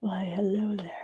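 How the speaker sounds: soft whispered hello; whispered playful greeting. Real, not acted. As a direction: hush it like a little secret. Greeting herself in the mirror.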